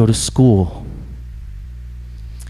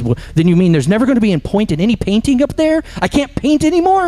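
high, indignant protest climbing higher; angry ghost; 8 semitones higher than usual. Acted. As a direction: demand an answer, outraged. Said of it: "got angry".

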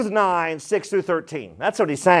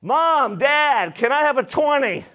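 put-on high-pitched kid asking parents for money; mock child wheedling Mom and Dad; pleading kid; 6 semitones higher than usual. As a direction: put on a higher kid's voice.

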